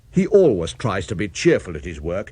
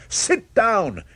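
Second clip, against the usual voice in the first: barked command, much higher; sudden shout; 10 semitones higher than usual. Acted. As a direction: snap it out to make the cow sit. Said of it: "the specialist suddenly shouted".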